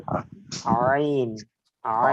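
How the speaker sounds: আইন stretched from mid-throat, slow; throat ain demo. Shown as a demonstration. Teacher demonstrates আইন slowly to the class. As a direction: squeeze আইন from the middle of the throat. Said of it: "সেখান থেকেই আইন উচ্চারণ হবে".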